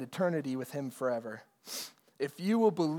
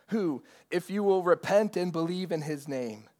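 usual speaking voice

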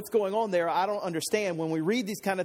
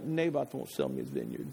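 low, soft sulky grumble; mock a big baby's pout, quiet and low; 6 dB quieter than usual. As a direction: sulk it out under the voice. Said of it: "he's pouting really big".

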